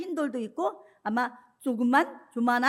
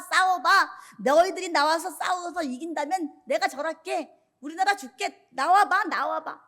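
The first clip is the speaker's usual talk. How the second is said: higher-pitched jeering challenge; retold battlefield insults, pitched up; taunting abuse; 4 semitones higher than usual. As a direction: goad the enemy to come out and fight. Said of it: "막 하나님을 욕하는 거야".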